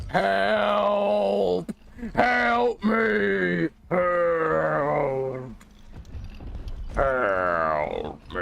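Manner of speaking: high hammed-up wail for help; mock cry for help. Player calls teammates for help, hammed-up high wail. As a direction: wail it like a damsel.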